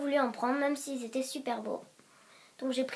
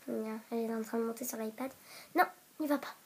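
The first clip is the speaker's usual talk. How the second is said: usual speaking voice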